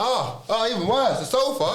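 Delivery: high, laughing mock outrage; shocked disbelief. Retelling to his friend how he reacted to the sofa, in laughing high-pitched disbelief. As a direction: act scandalised, cracking up as you go.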